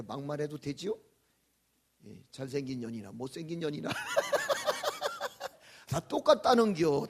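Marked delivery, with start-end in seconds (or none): 5.9-7.1 louder, laughing through the words. Acted. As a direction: laugh out the punchline. Laughing delivery of punchline.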